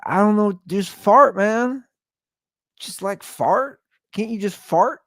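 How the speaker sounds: high, loud exasperated pleading; desperate plea. Retold or imitated. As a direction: beg the baby, at wit's end. Pleading with crying baby.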